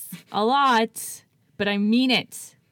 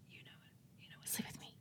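whisper; 28 dB quieter, much faster than usual. Real, not acted. Whispered mishearing of French.

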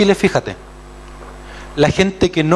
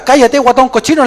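playful scold; 8 semitones higher, 6 dB louder than usual. Retold or imitated. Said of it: "le decía yo".

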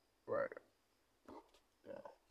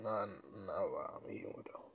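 whispered aside; 8 dB quieter than usual. Real, not acted. Aside muttered while eating.

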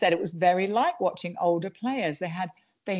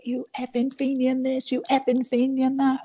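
high, ranting imitation of a bigoted football fan; mock sectarian heckler; 8 semitones higher than usual. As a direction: parody the abusive chant in a pitched-up voice. Imitating sectarian abuse at Old Firm.

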